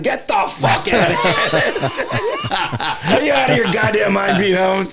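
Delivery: loud laughing outrage; mock outrage. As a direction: bellow it through laughter.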